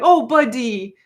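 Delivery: sympathetic, pitying "Oh, buddy" to a clumsy dog; like consoling a dog who bonked his head; rueful fond pity. Shown as a demonstration. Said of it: "That was the voice".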